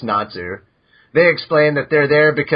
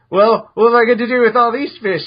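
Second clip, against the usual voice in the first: higher, louder put-upon boast; mock exaggerated; 8 semitones higher, 4 dB louder than usual. Natsu waving a haul of fish at sulking Happy, higher and louder. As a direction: overplay the fake problem, higher.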